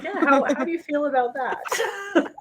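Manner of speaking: slow, high, laughing therapist question; mock the therapist, giggling. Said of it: "I was like".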